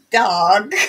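loud, drawn-out American accent. Shown as a demonstration. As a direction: say it loudly with an exaggerated American accent. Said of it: "with the American accent".

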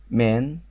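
مان with a long open vowel and rising end; model for imitation. Shown as a demonstration. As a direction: say مان clearly for learners to copy exactly. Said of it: "قولوا زي بالظبط، قلدوني".

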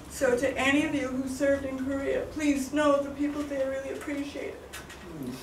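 holding back tears as you thank them; getting emotional. Real, not acted. Thanking Korea veterans in the room, voice breaking with emotion. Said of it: "Sorry, I'm getting emotional".

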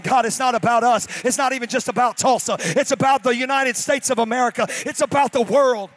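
loud earnest prayer shout; loud fervent prayer. Shown as a demonstration. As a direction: cry it out to God. Modeled prayer at full force.

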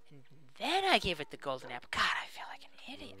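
whispery, soft dawning dismay; hushed self-reproach. Real, not acted. Player feeling like an idiot, hushed and whispery. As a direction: drop to a near whisper. Deflated on realizing the mistake.

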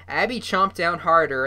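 ordinary delivery, nothing marked

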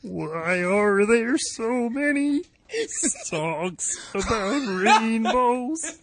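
slow and drawn out. Pretend. Asking the robot a question.

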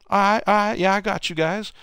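very high put-on voice; high-pitched, loud-starting mock acknowledgement; imitated star player. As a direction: mimic a provoked player accepting the challenge. Retold star answering the challenge.

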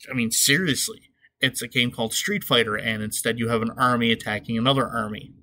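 ordinary talk, nothing marked

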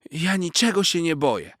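high, loud huffy denial; indignant retort. A narrator's reading. Proud cat huffily denying his fear, high and loud. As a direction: bristle and deny it. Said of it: "żachnął się Garfik".

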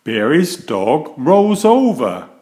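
plain reading voice

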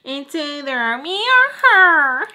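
high, slow mocking voice giving an ultimatum; mock the boyfriend's ultimatum, drawn out. Mocking mom's boyfriend giving her an ultimatum.

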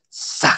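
Sa soplado, sin voz, desde el vientre; sa desde abajo. Shown as a demonstration. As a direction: suelta el Sa desde lo más profundo. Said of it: "el sa sale como desde los genitales". (Sa susurrado y profundo)

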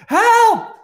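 very high, loud desperate scream; screaming for help. Acted. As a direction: yell for help at full force. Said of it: "someone screaming".

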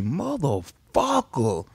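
slow, drawn-out impressed curse. Retold or imitated. Screw listening to the CD, slow impressed curse. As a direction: savor the music, stretched out. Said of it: "Screw say".

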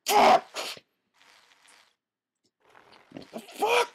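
very high, loud furious yelling; raging shouts. Real, not acted. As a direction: scream at the game in rage. Raging at repeated failure.

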